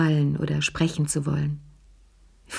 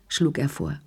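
usual speaking voice

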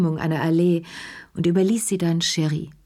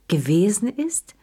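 "Gewesen ist?" rising with weight on ist; stressed question; 4 semitones higher than usual. A narrator's reading. Lea stressing the words back at Madame Pelou, rising. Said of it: "betonte Lea".

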